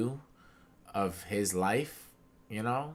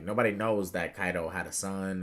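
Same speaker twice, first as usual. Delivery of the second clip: ordinary delivery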